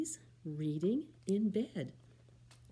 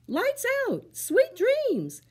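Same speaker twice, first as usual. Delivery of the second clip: high, louder mama firmly saying goodnight; 9 dB louder, much slower than usual. Acted. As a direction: tuck them in with a firm goodnight. Mama voiced.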